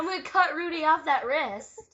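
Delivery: shouted threat, loud. Real, not acted. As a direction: yell it out as a threat. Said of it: "Wow, that was loud".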